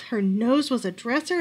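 ordinary delivery, nothing marked

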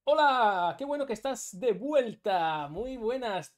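loud, high, drawn-out welcome; effusive greeting. Real, not acted. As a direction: call out a big hello, stretching it. Streamer greets a returning viewer.

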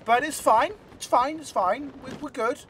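high, sing-song it's fine; forced reassurance. Real, not acted. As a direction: reassure yourself, a bit too brightly. Self-reassurance after mistakes.

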